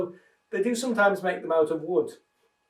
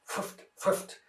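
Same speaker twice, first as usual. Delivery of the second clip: voiceless puffs of air; wolf blowing hard at the house; breathy puffing; 5 dB quieter than usual. Acted. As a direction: blow the words out as puffs. Blowing sound words.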